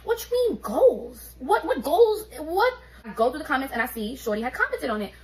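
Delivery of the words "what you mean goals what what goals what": high, louder disbelief; stunned, mocking echo; incredulous. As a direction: repeat goals like you can't believe it. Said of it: "And I'm here like".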